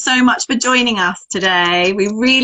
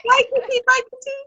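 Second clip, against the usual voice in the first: high giggly goodbye; giggly late night call; 13 semitones higher, faster than usual. Real, not acted. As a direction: coo the goodbye.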